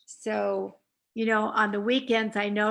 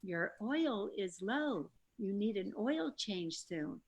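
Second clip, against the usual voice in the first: car voice; 11 dB quieter, slower than usual. Retold or imitated. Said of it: "it says".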